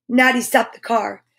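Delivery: ordinary delivery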